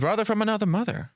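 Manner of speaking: high, delighted catchphrase echo; excited. Real, not acted. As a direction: sing it out high with glee. Echoing a friend's catchphrase.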